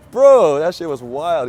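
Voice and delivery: loud, drawn-out disbelief; real astonishment, slow and loud; amazed. Real, not acted. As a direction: stretch the first word out, loud and incredulous. Still shocked by the officer.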